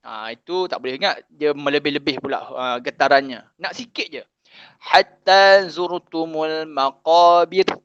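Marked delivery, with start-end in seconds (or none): 4.8-7.7 slow, drawn-out recitation; teacher modelling the verse for students, slow recitation; Quran recitation. Shown as a demonstration. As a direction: chant the verse slowly and evenly.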